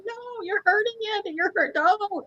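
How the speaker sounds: put-on very high, pleading child cry; a child protecting her food from the knife; squealing kid begging them to stop; distressed little girl. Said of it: "She's like".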